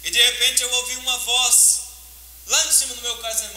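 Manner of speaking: ordinary delivery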